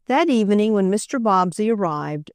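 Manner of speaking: ordinary delivery, nothing marked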